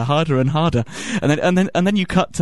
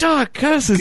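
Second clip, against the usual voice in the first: higher-pitched cry of thwarted scheming; mock villainous frustration; 11 semitones higher than usual. Anchor thwarted by the girl not crying, mock frustration. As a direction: curse like a foiled villain.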